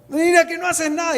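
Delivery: panicked servant. Acted. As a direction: cry out in alarm to the prophet. Servant panics before an army.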